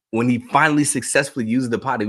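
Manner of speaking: ordinary talk, nothing marked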